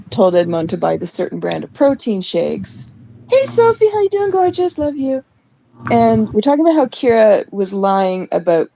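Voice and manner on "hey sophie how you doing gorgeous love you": high, sugary fake-sweet greeting; mocking sweet voice.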